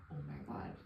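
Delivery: whispered, awed oh my God; soft, overwhelmed gasp of words; breathless oh my god. Retold or imitated. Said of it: "I was like".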